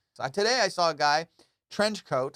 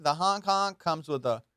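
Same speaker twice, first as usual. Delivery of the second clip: ordinary talk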